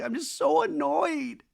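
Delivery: very high, drawn-out imitated whine; hammed-up upset wail on the brink of tears; mock tearful. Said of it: "Lexi's starting to tear up".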